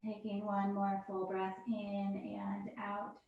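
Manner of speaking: slow, soft whispered breath cue. Real, not acted. Yoga teacher guiding the class's breath, whispered. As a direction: breathe the words out gently.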